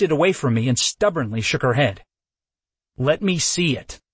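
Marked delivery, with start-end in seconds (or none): 3.0-4.0 demanding. A narrator's reading. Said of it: "I demanded".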